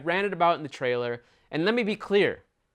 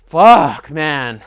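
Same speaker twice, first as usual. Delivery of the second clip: sudden exasperated shout; loud curse; 11 dB louder than usual. Real, not acted. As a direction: blurt it out loud in frustration. Exasperated outburst.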